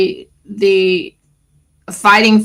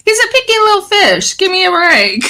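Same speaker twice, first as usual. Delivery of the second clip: high, fast squeaky fish voice; voicing the fish; 11 semitones higher, faster than usual. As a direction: play the fussy little fish in a high voice. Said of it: "He's like".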